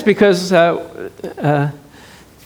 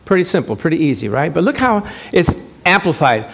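usual speaking voice

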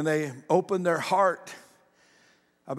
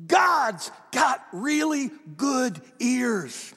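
loud, high, ringing preacher's call to the congregation; full-force preaching; 8 semitones higher, 5 dB louder than usual. Acted. Pastor preaching hard to the congregation, loud and high. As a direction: preach it out big over the music.

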